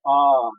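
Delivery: "आ" held long. Shown as a demonstration. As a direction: draw out the "आ". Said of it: "आ को पढ़ने में ... इसमें लगाएंगे।". (लंबा "आ")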